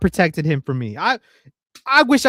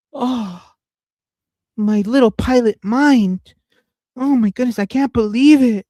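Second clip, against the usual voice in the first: mock amazement; 6 semitones higher than usual.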